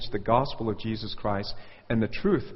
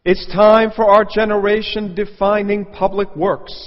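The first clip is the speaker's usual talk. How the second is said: higher, louder, pompous orator's voice; mock grand speech; 7 semitones higher, 10 dB louder than usual. Preacher mocking a politician's speech, pompous and loud. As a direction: puff it up like a grandstanding speaker. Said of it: "So quote".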